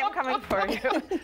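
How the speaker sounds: slow spooky mock threat; spooky ghost voice. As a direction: creep it out slowly like a ghost. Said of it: "That'd be a scary sight".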